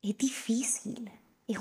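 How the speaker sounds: soft slow whisper. Real, not acted. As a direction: breathe it out, slow and hushed. Emotional confession about self-love.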